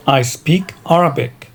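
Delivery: usual speaking voice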